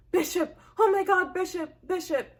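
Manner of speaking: mild mock panic over her husband; panicked call. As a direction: voice the wife calling him in panic. Wife finds him unresponsive.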